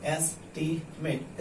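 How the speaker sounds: "Estimate" broken softly into es, ti, met; soft teacherly sounding-out of "estimate" with gaps; syllable by syllable. Shown as a demonstration. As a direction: split "estimate" into three separate beats. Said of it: "syllable में बांट दीजिए".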